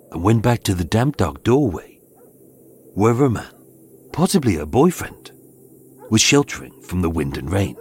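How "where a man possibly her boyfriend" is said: usual speaking voice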